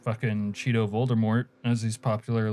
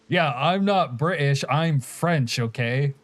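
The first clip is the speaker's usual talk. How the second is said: mock indignant Canadian; 4 semitones higher, slower than usual. Streamer mocking Canadians insisting they're French, mock indignant. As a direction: huff it like an offended Quebecer.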